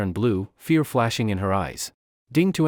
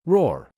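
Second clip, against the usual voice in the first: a high, loud bear roar; wounded bear roaring; bear roar of pain; 5 semitones higher, 3 dB louder than usual. A narrator's reading. Bear stabbed.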